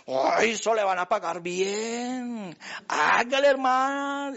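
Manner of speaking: a touch of a wheedling flatterer, slowed; lightly voiced eager 'hágale, hermano'; money-minded adviser. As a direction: coax him toward the cash. Money-loving adviser urging.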